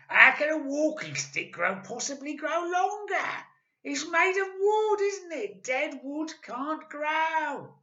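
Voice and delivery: loud, high mocking old man, slowing at the end; scornful Mister Twit. A narrator's reading. Husband mocking his wife's worry, loud and scornful. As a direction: lecture her with scorn. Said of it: "Mister Twit said".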